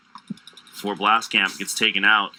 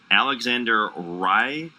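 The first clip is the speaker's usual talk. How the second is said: ordinary delivery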